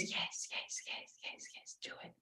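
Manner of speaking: eager little whisper, repeated urgently; hushed, barely voiced urging; inner voice whisper. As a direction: whisper encouragement from inside the head. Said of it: "this voice that it says".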